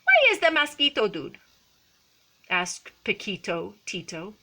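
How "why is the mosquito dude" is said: high, loud, quick little voice; tiny Chihuahua. A narrator's reading. Smallest of the band asks Skippy, quick high voice. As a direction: pipe up as the smallest dog. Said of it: "the smallest of the small ones spoke up".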